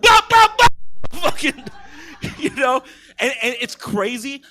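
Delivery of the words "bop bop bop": high, loud comic bop bop bop; mock impact noises. The dad backing up as he is hit, loud comic bops. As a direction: punch out each bop like a cartoon hit.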